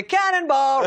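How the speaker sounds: high excited whoop; gleeful yell. Retold or imitated. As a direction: shout it mid-jump. Guy jumping into pool.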